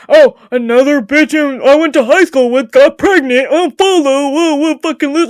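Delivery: loud mocking voice of people who unfollow; mocking the unfollowers. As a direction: sneer it like a petty old classmate. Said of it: "'cause they're like".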